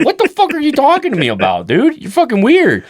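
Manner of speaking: squeaky laughing what are you talking about; high incredulous outburst. Retold or imitated. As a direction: yelp it at your weird dad. Son retells reacting to dad.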